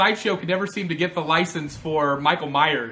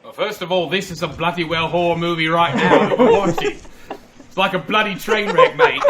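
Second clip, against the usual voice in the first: Captain Jack Sparrow; 5 dB louder than usual. Acted. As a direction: play the cheeky rum-soaked pirate captain. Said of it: "It's actually Captain Jack Sparrow".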